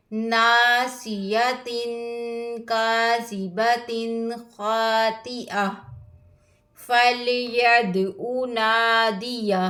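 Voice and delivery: slow, drawn-out melodic recitation; Quran recitation. Acted. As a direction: chant each verse with tajweed. (धीमी क़ुरआन तिलावत)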